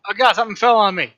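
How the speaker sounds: high, loud, quick exclamation; startled outcry. Real, not acted. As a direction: blurt it out, startled. Object falls on the player mid-game.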